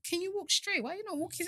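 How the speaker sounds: quoted questioning. Retold or imitated. Friend quoted questioning her walk.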